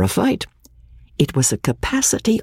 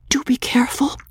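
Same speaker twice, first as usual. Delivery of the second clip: anxious whisper; 12 semitones higher, faster than usual. A narrator's reading. Magnolia warns Ravenel quietly.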